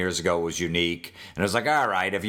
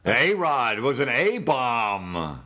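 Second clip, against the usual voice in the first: slow, drawn-out announcer call; slow hammed-up home run call; imitating announcer John Sterling; 3 semitones higher, much slower than usual. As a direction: ham up the catchphrase like a radio man. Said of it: "his fucking awful catchphrases".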